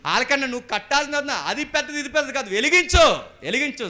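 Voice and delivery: very high, shouted sermon; full-force preaching. Acted. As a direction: drive each phrase at the congregation, loud and high. (పెద్ద గొంతుతో ఉద్వేగంగా ప్రసంగం)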